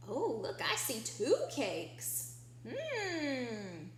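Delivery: ordinary talk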